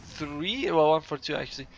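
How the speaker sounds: normal talking voice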